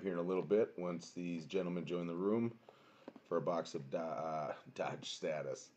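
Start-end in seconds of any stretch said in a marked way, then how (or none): none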